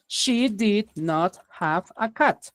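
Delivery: each word stretched out for learners; slow model sentence. Shown as a demonstration. Teacher reading the example to the class, slow. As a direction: read it slowly, word by word. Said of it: "Y aquí abajito".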